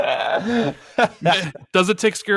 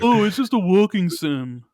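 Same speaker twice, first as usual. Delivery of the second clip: mock impression of friend; 3 semitones higher, 3 dB louder than usual. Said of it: "He's, he's always calls these".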